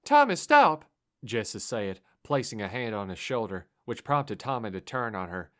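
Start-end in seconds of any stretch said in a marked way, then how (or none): 0.1-0.9 high, loud desperate cry; pleading shout. Acted. Girl begging the driver to stop, loud cry.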